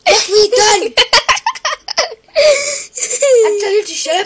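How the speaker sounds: high, loud, shrieking through laughter; laughing shout. Real, not acted. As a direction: yell while helpless with laughter. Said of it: "You mean laughing?".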